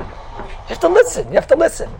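breathy high appeal to listen; urgent plea. Real, not acted. Rabbi urging his class to listen closely. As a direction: press the students to really hear.

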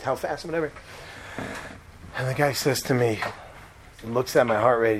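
ordinary delivery

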